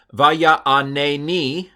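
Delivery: Vaya aneni read slowly, syllable by syllable; careful Hebrew reading. Shown as a demonstration. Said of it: "that's a fun word".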